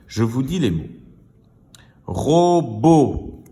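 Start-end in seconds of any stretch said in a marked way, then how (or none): 2.0-3.4 "Robot" loud and high, in two drawn-out syllables; final-sound demonstration. Shown as a demonstration. Teacher asking pupils for the last sound, drawn-out "Robot". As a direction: stretch "Robot" so the final O stands out. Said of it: "Par quel son finit-il?".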